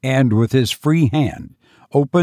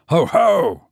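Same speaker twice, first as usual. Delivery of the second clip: booming mock laugh, raised pitch; roared; 7 semitones higher than usual. A narrator's reading. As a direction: roar it out like a madman. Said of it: "he roared".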